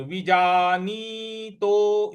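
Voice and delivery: "विजानीतो" with short vi and drawn-out jaa-nee-to; long ee demo. Shown as a demonstration. Teacher shows the long vowel, "विजानीतो" drawn out. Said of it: "इस प्रकार से बोलेंगे".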